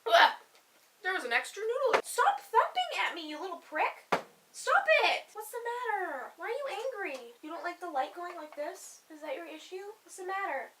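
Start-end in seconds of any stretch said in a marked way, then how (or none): 2.0-5.3 higher, sharp telling off of the animal; scolding the thumping pet. Real, not acted. 5.3-10.7 pet talk. Real, not acted. Soothing the pet.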